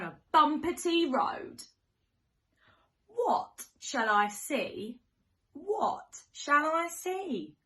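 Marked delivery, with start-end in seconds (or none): none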